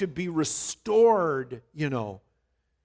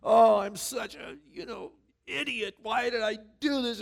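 high-pitched mock sobbing apology; a lightly voiced weepy apology; mock tearful apology; 8 semitones higher than usual. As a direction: ham up a crying confession. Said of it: "break down and go".

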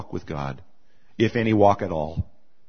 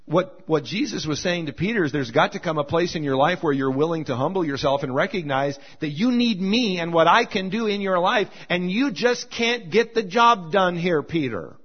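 preaching; 6 semitones higher than usual. Acted. Impassioned preaching.

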